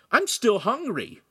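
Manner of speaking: high, slow hungry whine; whining. Acted. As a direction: drag it out like a sulky kid. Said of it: "Lincoln complained".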